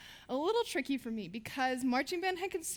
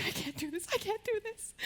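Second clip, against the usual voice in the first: soft, whiny mock panic, rising higher on the repeat; imitating scared brothers; 6 semitones higher, 6 dB quieter than usual. Imitating brothers before the flu shot, mild mock panic. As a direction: play the brothers losing their nerve, softly. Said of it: "they were freaking out".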